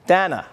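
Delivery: Dana said DAN-uh, as one firm answer; short A in Dana. Shown as a demonstration. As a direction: make Dana rhyme with banana. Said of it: "Rhymes with banana".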